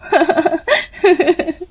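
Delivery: high mocking cackle; mocking laugh. A narrator's reading. Rupa laughs at Raja mocking him. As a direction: cackle at the boy. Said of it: "रूपा जोर जोर से हंसने लगती है".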